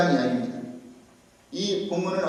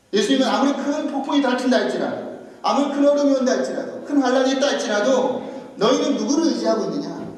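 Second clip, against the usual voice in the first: higher, insistent pulpit delivery; forceful preaching; 6 semitones higher, 5 dB louder than usual. Real, not acted. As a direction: press each point home to the congregation. (힘주어 높인 설교조)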